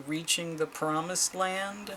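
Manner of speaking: ordinary talk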